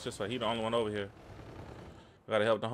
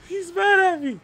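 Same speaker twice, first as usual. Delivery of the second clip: much higher and louder, cackling at him; gloating laughing shout; 13 dB louder than usual. Real, not acted. Gamer mocking an angry opponent, shouting with laughter. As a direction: yell it out, then laugh hard.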